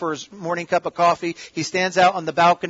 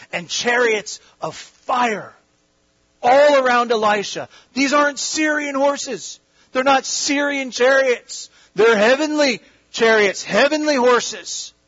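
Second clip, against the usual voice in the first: higher and louder, slow and swelling; preaching at full force; 7 semitones higher, 3 dB louder, slower than usual. Acted. Preacher to congregation, building to a fervent peak. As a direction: lift the hall with the vision. Preaching climax on heavenly chariots.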